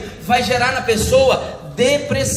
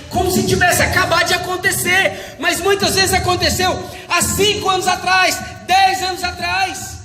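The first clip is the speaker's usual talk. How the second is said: rising preaching; 5 semitones higher than usual. Real, not acted. Preacher builds emphasis.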